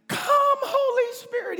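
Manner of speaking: mock crying prayer. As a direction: overdo a tearful plea, then break into a laugh. Mocking pleading prayer.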